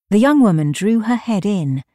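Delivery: usual speaking voice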